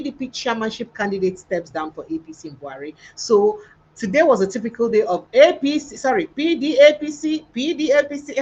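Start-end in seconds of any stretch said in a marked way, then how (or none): 5.3-8.3 mocking correction. Real, not acted. Mocking slip, party switching.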